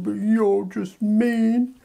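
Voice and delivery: put-on high, slow, hurt child whine; whiny child. UK as child scolded by the EU, hurt whine. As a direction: whine it like a kid told off.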